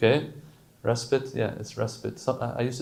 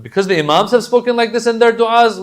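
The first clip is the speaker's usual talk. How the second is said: preaching emphasis; 10 semitones higher, 8 dB louder than usual. Real, not acted. Preacher rises to full force.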